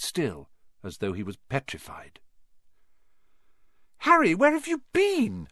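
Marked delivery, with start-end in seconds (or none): 4.0-5.5 very high squeak; squeaking Hermione. A narrator's reading. Hermione worried, squeaking at Harry who returns late. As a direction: squeak the question out, much higher. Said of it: "Hermione squeaked".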